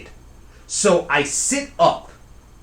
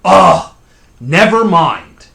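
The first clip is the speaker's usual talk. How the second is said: loud, slow, drawn-out dismissal; exasperated yell; 4 semitones higher, 13 dB louder, slower than usual. Retold or imitated. Cousin giving up on the sleeper upstairs, loud exasperated yell. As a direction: imitate a fed-up holler from downstairs. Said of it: "she says, clear as day".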